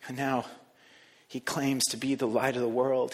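ordinary talk, nothing marked

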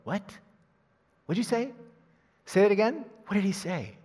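high, eager confused questions; puzzled listener. Acted. Crowd in Galilee questioning what Jesus said, puzzled. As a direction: ask as if you barely caught it. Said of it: "He wants people to go".

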